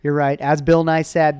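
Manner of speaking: usual speaking voice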